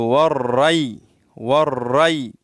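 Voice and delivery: والری slowly as "war-ray", rolled doubled r; soft ya diphthong. Shown as a demonstration. Teacher modelling the soft ya, slow "war-ray". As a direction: glide والری open into "ay", never "eh". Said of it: "تو یا لین ہے یہ".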